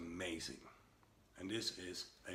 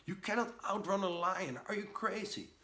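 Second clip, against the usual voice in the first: much higher, loud panicked yell; panicked screaming; 11 semitones higher, 6 dB louder than usual. Retold or imitated. Panicked man yelling at his calm friend about the lion. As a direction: scream at him in total panic. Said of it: "screaming in total panic".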